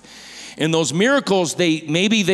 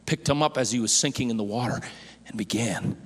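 much softer and lower, almost a whisper at the end; hushed; 6 semitones lower, 9 dB quieter than usual. Acted. Preacher retelling Peter's rescue to the church, in a hush. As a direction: draw the congregation in quietly.

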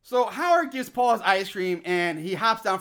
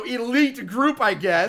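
normal talking voice

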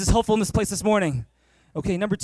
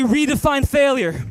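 call and response; 5 semitones higher, 8 dB louder, slower than usual. Shown as a demonstration. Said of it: "Everyone say".

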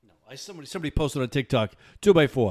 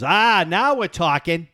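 slow New England drawl, higher and louder; New Hampshire accent; 7 semitones higher, 6 dB louder, slower than usual. Retold or imitated. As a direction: Alan pleased, in broad Boston-area accent. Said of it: "your heavy New Hampshire accent".

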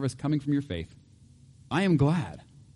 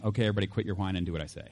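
fast, clipped mock command, a touch quieter; mock bossy order; 6 semitones lower, 6 dB quieter, much faster than usual. Preacher mocking a misreading of the verse, fast and bossy. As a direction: rattle off a dismissive boss's order. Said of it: "This can't possibly mean".

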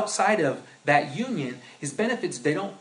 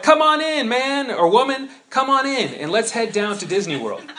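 high, loud hammed-up welcome; excited invitation; 8 semitones higher, 7 dB louder than usual. Pastor calling the listener into his car, high and loud. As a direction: call them in, bursting with glee. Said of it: "So I'm like".

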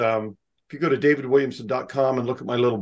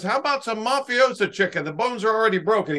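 a hint of a high, fast hard sell; pushy counter guy; 8 semitones higher than usual. Counter guy lightly voiced pushing mafiosa chicken. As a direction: pitch the broken-bone chicken. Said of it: "he goes".

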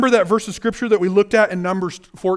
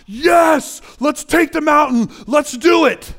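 mock church hype; 5 semitones higher, slower than usual. Preacher mimicking churchgoers' easy enthusiasm in church, mock excited shout. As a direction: pump it up like a fired-up churchgoer. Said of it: "when we're sitting in church, it's like".

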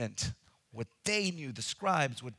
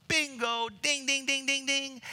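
high, loud ding-ding chant; game-show bell; 10 semitones higher, 4 dB louder than usual. Pretend. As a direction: play the winning-answer bell. Game-show buzzer bit.

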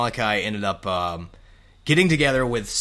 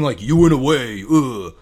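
mock disdainful accusation; 3 semitones higher, 8 dB louder than usual. Shown as a demonstration. Host showing what he didn't mean, mock disdain. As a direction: say it loudly as if put out. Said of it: "I'm not saying".

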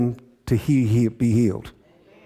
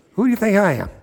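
higher, mock-indignant challenge; mildly upset, retold; 6 semitones higher, faster than usual. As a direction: act out an offended king's retort. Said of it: "he got upset".

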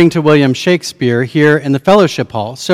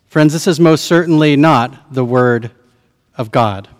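usual speaking voice

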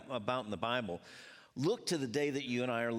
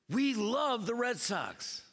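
fan enthusiasm; 8 semitones higher, slower than usual. Retold or imitated. Acting out Red Sox fans.

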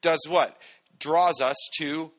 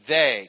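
"they" punched louder, held apart; stress on they; 2 semitones lower, 6 dB louder than usual. Shown as a demonstration. Preacher pointing out the word "they". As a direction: lean hard on "they". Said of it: "notice he says".

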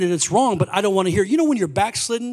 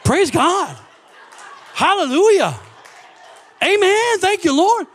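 climbing higher and louder with each praise; excited praise shouting; 10 semitones higher, 4 dB louder than usual. Retold or imitated. Driving home, praising God louder and louder, ecstatic. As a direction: shout it out, the car is running and you can't believe it. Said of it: "I was going".

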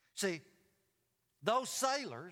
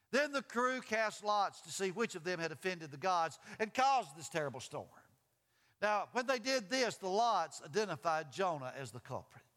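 loud-edged, higher, animated Bible story; dramatic scripture reading; 6 semitones higher, 4 dB louder than usual. A narrator's reading. Preacher reading the storm at sea to the congregation, dramatic. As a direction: read the verses out with storytelling force.

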